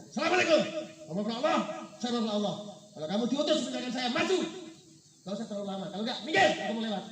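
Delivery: loud, high-pitched bellowed challenge; retold snapping shout. Ustadz confronts the tiger blocking his road, retold snapping shout. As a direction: act out yelling down a tiger with bold bluster. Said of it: "lalu dia bentak harimau".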